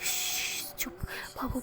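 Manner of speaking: a soothing shh, barely voiced; whispering to calm a crying partner; shushing whisper. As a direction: hush her in a whisper. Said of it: "उसे चुप करवा रही होती है".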